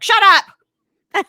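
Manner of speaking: sudden high, loud yell; mom's angry shout. Acted. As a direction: explode at Dad as the fed-up mom. Acting mom snapping at dad.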